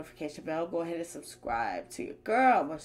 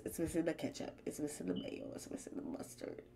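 each line softer until a whisper; fading to a whisper; 3 semitones lower, 12 dB quieter, faster than usual. Pretend. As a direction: let it trail off into a whisper. Listing condiments, voice fading away.

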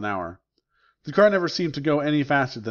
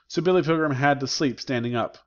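usual speaking voice

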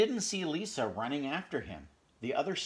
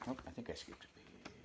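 whispered aside; 11 dB quieter, faster than usual. Real, not acted. Reader aside, page skipped.

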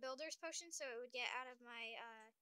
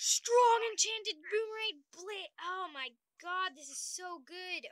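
excited; 4 semitones higher, 9 dB louder, slower than usual. Real, not acted. Thrilled by new weapon.

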